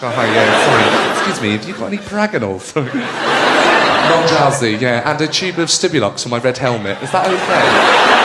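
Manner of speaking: lightly voiced embarrassed customer at a chemist, laughing; awkward English shopper. Comic acting out a customer asking a chemist, awkward laughing. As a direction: mumble politely through a laugh.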